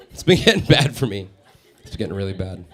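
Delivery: ordinary delivery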